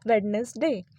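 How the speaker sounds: वेडनेसडे with every letter, wed-nes-day; wrong Wednesday. Shown as a demonstration. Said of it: "गलत pronunciation". (वेडनेसडे गलत, हर अक्षर बोलकर)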